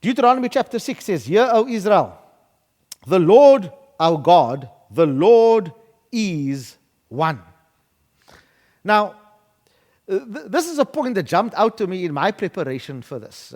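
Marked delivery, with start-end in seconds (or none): 1.2-7.4 slow, weighty recitation with spaced phrases; solemn scripture reading. Acted. Preacher quotes Deuteronomy to the church, slow and solemn. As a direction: draw out each phrase, dropping low at the end.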